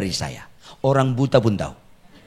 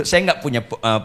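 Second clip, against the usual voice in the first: normal talking voice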